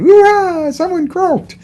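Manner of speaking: inappropriately gleeful hooray; mock cheer. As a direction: cheer it like a party at a funeral. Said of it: "jumping up and down, celebrating, going".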